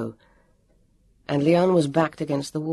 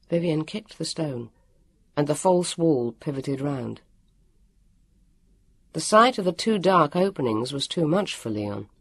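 ordinary delivery, nothing marked

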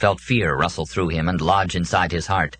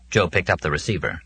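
usual speaking voice

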